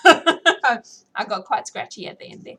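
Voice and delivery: laughing slowly through it. Real, not acted. Reader to chat about a sore voice, laughing. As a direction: chuckle out the words.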